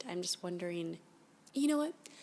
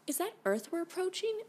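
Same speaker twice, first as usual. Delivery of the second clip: high, louder startled question; alarmed realization; 10 semitones higher, 5 dB louder than usual. Acted. Captive sees Earth approaching and gasps in startled surprise. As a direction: it suddenly dawns on her.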